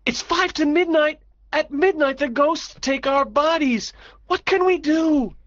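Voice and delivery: high, urgent alarmed voice; panicked. Acted. Nick warns friends midnight is near, panicked. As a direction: plead urgently for a way out.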